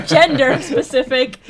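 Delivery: said through laughter, slow; amused protest through laughter; laughing. Real, not acted. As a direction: laugh while teasing back. Laughing at friend's jab.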